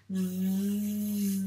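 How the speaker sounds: "Vi" with a very long v, then held i; sounded-out syllable. Shown as a demonstration. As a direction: buzz the v of "Vi" slowly as if writing it. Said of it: "donc on doit le dire, les sons".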